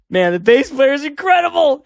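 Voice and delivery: high, loud amazed exclamation. Retold or imitated. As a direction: rave about the bassist in awe. Amazed band watching young bassist.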